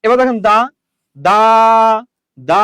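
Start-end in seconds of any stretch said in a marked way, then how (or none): 1.2-2.6 দা with a long open aa; drawn-out, separate দা; open syllable. Shown as a demonstration. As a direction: hold the vowel of দা, leaving it unblocked. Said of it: "অর্থাৎ এটা মুক্ত".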